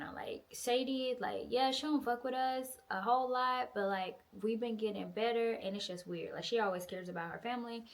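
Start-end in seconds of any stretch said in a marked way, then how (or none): none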